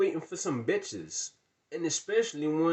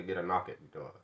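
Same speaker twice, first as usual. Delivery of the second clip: normal talking voice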